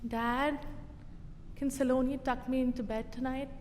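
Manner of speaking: softly voiced little kid asking; quiet, tentative imitation of a child; soft child's request. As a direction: ask Dad gently, keeping it small. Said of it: "V gets up and softly goes".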